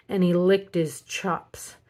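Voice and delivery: ordinary delivery, nothing marked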